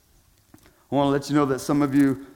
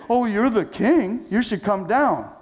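mocking; 7 semitones higher than usual. Scoffers taunting Jesus to come down, jeering voice. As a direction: sneer it like the scoffers. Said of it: "scoffing and mocking him".

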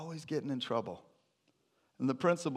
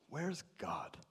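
quiet question; 4 semitones higher, 9 dB quieter than usual. Retold or imitated. Principal quietly asks the boy where God is. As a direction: pose it softly at first, almost gently.

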